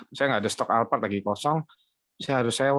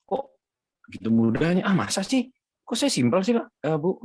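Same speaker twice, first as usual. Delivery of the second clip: high, incredulous questions; amazed disbelief; 8 semitones higher than usual. Retold or imitated. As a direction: sound like you can't believe it was that easy. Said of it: "mereka semua kaget".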